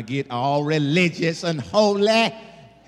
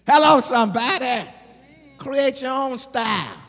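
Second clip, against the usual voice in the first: higher, louder call to the crowd; preaching shout; 10 semitones higher, 6 dB louder than usual. Acted. Preacher calling out to his church, loud and high. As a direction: rouse the congregation. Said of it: "preacher calls out".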